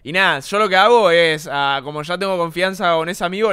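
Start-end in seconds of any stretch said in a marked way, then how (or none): none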